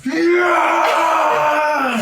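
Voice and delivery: very high, loud victory shout; triumphant yell. Acted. Hitmonlee cheering after the fight, loud high shout. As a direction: cheer it out at full force. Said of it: "he just goes".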